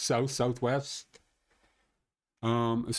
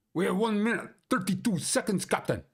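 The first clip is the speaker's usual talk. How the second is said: put-on high urgent Scottish accent, calling to the captain; mock Scotty Scottish brogue; 9 semitones higher, faster than usual. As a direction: report the countdown like a panicked Scottish engineer. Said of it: "your stupid Scottish accent".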